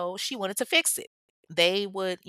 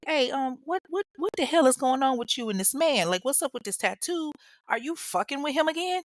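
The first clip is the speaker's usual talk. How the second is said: higher, mock-heated confrontation; confronting; 7 semitones higher than usual. Husband confronts wife about the tattoo, mock-heated. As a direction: demand answers from her. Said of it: "and he's like".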